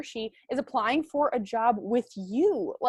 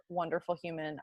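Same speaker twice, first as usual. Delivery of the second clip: ordinary talk, nothing marked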